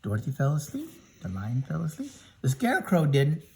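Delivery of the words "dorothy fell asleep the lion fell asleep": usual speaking voice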